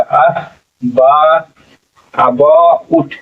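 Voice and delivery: आ, बा, अबाउट very slowly, vowels drawn out; sounded out. Shown as a demonstration. Teacher shows which sound it gives, अबाउट sounded out slowly. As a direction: stretch the middle of अबाउट, "a-baw-ut", piece by piece. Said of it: "ये वाला sound देगा".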